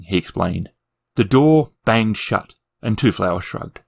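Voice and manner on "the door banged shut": usual speaking voice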